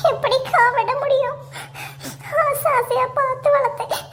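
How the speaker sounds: high-pitched put-on sobbing; fake crying. Playing hurt over a cherished plant, fake crying. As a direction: wail it out as pretend tears. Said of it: "அழுத மாதிரி இருக்கேன்".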